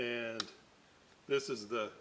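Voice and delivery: ordinary talk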